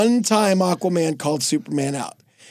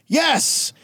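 higher-pitched, commanding hero announcement; Superman butting in; 6 semitones higher than usual. Acted. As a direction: cut in like a self-important Superman. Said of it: "Superman would butt in".

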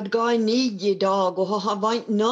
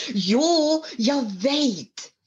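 bright burst, much higher on jo; eager daughter; 7 semitones higher, much slower than usual. A narrator's reading. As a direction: jump in eagerly with the answer. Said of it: "sa Sali-Maj".

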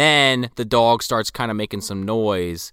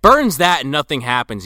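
ordinary talk

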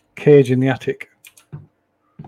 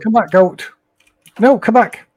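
raised, higher calling after it; 7 semitones higher, 5 dB louder than usual. Real, not acted. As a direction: shout it back, pitch rising. Chasing a goat, calling it back.

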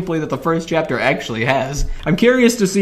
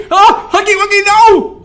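very high, loud scream; terrified scream; 8 dB louder than usual. Real, not acted. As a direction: scream for your life. Huggy Wuggy attacks.

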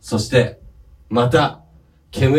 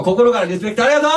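high, fast cry of thanks; shouted tribute; 8 semitones higher, 3 dB louder, faster than usual. Pretend. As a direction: finish with an all-out shout of respect. Shouted tribute ending the bit. (叫ぶように感謝を伝える)

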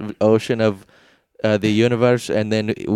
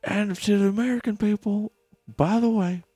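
presidential address; 7 semitones higher, 5 dB quieter than usual. Pretend. Mock address to American people.

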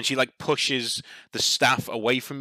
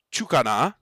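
questioning dryad; 4 semitones higher, 4 dB louder than usual. Acted. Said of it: "cocks her head a little bit".

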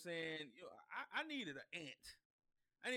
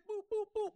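high, loud imitated boops; punchy high-pitched sound effects; playful action noises; 11 dB louder than usual. Imitates rapidly fixing things in chaos.

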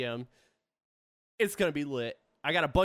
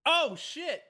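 surprised; 11 semitones higher than usual. Real, not acted. Suddenly remembers his stream.

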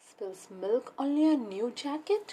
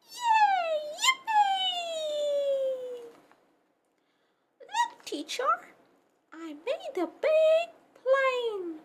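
very high cheering squeal; thrilled puppy; 14 semitones higher, 4 dB louder than usual. Acted. Puppy Pepper cheers and shows the teacher his plane, high and excited. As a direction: burst with happiness.

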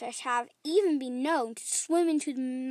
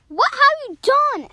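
high, loud storybook outcry; alarmed accusation; 10 semitones higher, 7 dB louder than usual. Acted. Fish to the crying shark, high alarmed demand. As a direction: demand it loudly in a raised pitch.

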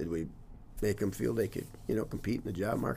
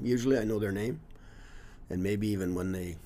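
ordinary delivery

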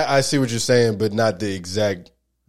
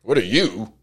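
ordinary talk

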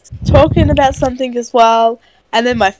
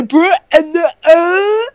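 higher, hammed-up imitation of a friend; mocking friend's speech; 4 semitones higher, slower than usual. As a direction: exaggerate his halting, grunting way of talking. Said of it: "The one that talks like this".

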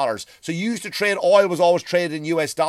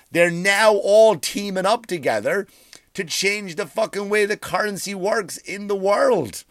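normal talking voice

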